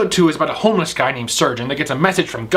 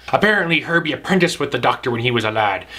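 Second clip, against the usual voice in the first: ordinary delivery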